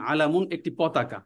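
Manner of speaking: ordinary talk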